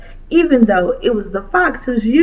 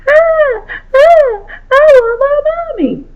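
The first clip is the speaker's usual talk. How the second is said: high, loud sobbing wail; loud crying wail; 3 dB louder, slower than usual. Acted. Little girl wailing for her mommy, inconsolable. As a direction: howl it like an inconsolable toddler. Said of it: "continued to cry".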